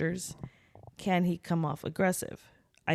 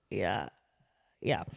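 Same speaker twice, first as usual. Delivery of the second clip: subdued; 11 semitones lower, 7 dB quieter than usual. Retold or imitated. Her underwhelmed reply to amazement.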